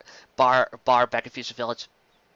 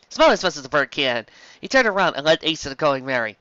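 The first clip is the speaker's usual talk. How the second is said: plain reading voice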